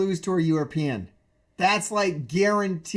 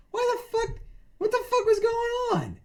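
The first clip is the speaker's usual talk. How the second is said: high, confused mock outburst; baffled; 3 dB quieter than usual. Imitating a viewer confused by the attack, high. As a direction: squeak out the confusion. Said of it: "you go".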